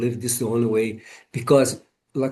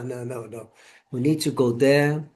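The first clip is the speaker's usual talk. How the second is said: ordinary talk, nothing marked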